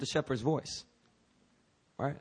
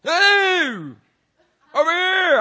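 high, loud holler; shepherd's call; 10 dB louder, much slower than usual. Acted. Shepherd calling his sheep, loud holler. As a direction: call across a field to stray animals. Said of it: "the shepherd has to, like, do this".

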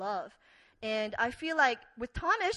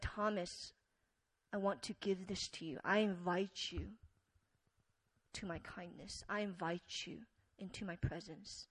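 soft and quiet, gently inviting; quiet tender invitation to a doubting friend; gentle invitation; 4 semitones lower, 9 dB quieter than usual. Acted. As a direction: speak it low and kind. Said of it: "through that one gentle, gentle moment".

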